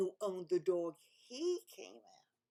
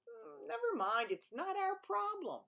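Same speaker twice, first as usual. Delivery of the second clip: high, airy royal brush-off; dismissive king; 10 semitones higher than usual. Acted. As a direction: wave it away like a careless king. King dismisses advisor in story.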